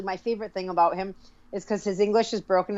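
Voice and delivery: normal talking voice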